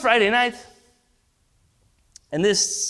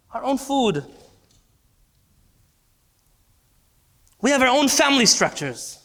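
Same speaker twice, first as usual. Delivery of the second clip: raised, louder pulpit cadence on "own"; rally the congregation with pride; building sermon climax; impassioned preaching; 4 semitones higher, 3 dB louder than usual. Acted. Preaching at full force.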